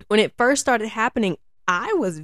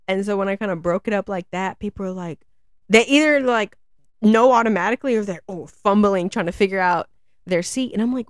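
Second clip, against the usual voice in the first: ordinary talk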